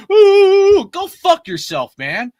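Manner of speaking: a squealing high "Ooh" before the taunt; mock whiny young fan, hammed-up. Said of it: "You got all these younger fans like".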